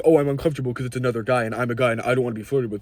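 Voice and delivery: fast, whiny mock of an uneasy guy; mocking straight guy. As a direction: rattle off his excuse like a parody.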